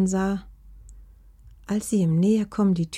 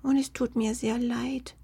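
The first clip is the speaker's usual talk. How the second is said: higher, soft small creature voice; small, contrite voice; apologetic little fox; 6 semitones higher, 4 dB quieter than usual. A narrator's reading.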